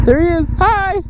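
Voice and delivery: high-pitched little squeal; delighted greeting. Real, not acted. Vlogger saying hi to the prairie dog, delighted high voice. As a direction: greet a cute animal in a high voice.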